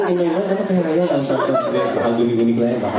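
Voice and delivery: fast, laughing puzzled aside; fast giggly self-talk; giggly wonder. Retold or imitated. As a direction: laugh it off quickly.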